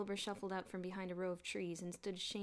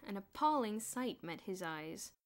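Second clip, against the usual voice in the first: ordinary delivery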